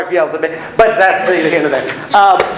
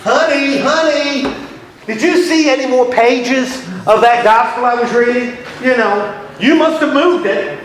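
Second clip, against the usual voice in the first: mock husband; 7 semitones higher than usual. Acted husband searching for pages.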